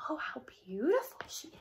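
hushed admiring whisper; awed whisper from the crowd. A narrator's reading. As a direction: breathe it in awe. Crowd admiring Cinderella aloud.

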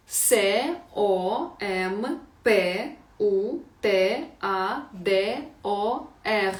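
«О М П У Т А Д О Р» дуже повільно, по літері; диктуй, ніби учні записують; повільна диктовка. Shown as a demonstration. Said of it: "Давайте ще раз". (вчителька диктує довге слово)

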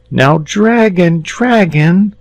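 put-on high, slow pleading boy; imploring. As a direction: beg him gently but urgently. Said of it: "said the boy imploringly".

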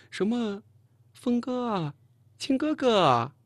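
high, drawn-out mocking mimicry. A narrator's reading. Colleague mocking how the girl talks, syrupy high imitation. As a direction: exaggerate a sickly-sweet girlish endearment. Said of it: "说话可真够酸啊".

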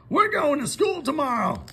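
the farmer bursting with news; excited Farmer Brown. Acted. Farmer Brown tells the cows about school, excited. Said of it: "he announced".

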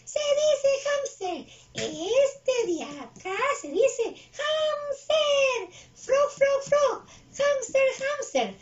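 high, squealing puppet pupil; very high character voice, slow and stretched; Jelly Bean. Acted. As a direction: repeat the words in a loud cartoon voice. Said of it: "A ver, dilo, dilo".

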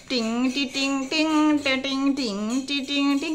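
playful hummed tune; sung music intro. Pretend. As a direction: mimic a jingle before the song. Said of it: "ধরে মিউজিকটা করো". (মুখে গানের বাজনা নকল করা)

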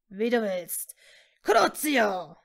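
dark lord hissing out a curse; hissed. A narrator's reading. Voldemort casts a curse at Hermine, hissing. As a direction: menace building to a loud spell. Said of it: "zischte er".

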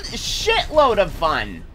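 normal talking voice

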